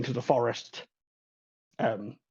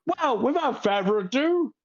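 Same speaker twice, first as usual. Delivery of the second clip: lightly voiced host, higher; a hint of the host starting the festival; showman host; 8 semitones higher than usual. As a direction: wrap it up briskly like an emcee. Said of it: "Leo goes".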